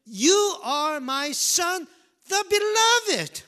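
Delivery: high, slow proclamation as God's voice; voice from heaven. Acted. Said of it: "the voice came from heaven".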